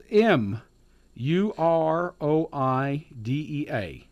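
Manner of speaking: slow, letter-by-letter spelling; measured dictation of single letters. Real, not acted. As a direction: spell it out slowly, each letter separate. Spelling out a difficult name.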